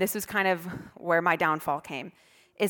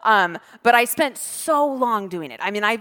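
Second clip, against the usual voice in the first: ordinary talk, nothing marked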